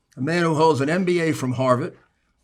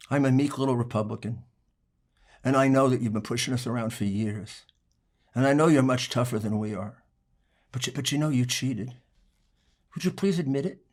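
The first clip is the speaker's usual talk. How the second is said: soft, lower, mock-timid pleading; a softer, lower voice feigning meekness to an opponent; mock meek politeness; 4 semitones lower, 5 dB quieter than usual. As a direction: play the meek little Republican, sweetly sarcastic. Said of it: "just show it to him very nicely, very politely".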